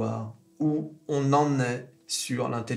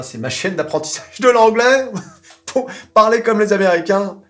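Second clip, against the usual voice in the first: laughing; 8 semitones higher, 9 dB louder than usual. Real, not acted. Joking plug, laughing.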